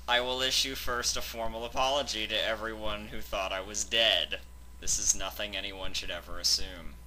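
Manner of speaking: usual speaking voice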